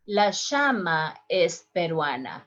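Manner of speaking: llama with a sh sound, "shama"; third way. Shown as a demonstration. Teacher demos llama with sh. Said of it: "in the three different ways".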